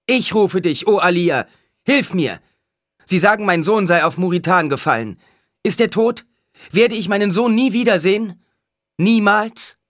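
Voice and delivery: loud, high-pitched plea; desperate crying out. A narrator's reading. Mother asks the oracle about her fallen son, crying out. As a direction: beg for help at full voice. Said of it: "rief die Frau".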